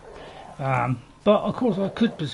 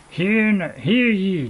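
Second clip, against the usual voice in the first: town crier call; 5 semitones higher, 7 dB louder, much slower than usual. Pretend. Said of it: "I'll start again".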